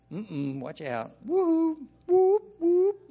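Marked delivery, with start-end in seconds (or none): 1.2-3.0 very high, loud siren whoops; playful mock alarm siren; robot alarm whoop. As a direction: imitate a robot's alarm going off. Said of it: "Warning, Will Robinson".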